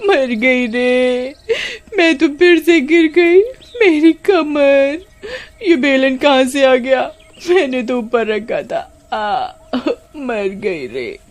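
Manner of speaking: high, slow moaning over her hurt back; pained wailing. Acted. Kamini fallen on the rolling pin, high pained wailing. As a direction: whimper and groan after the fall.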